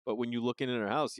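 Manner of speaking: ordinary talk, nothing marked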